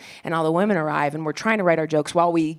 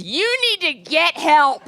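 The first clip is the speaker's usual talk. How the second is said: mock yell; 9 semitones higher, 3 dB louder than usual. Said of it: "she screams in my face".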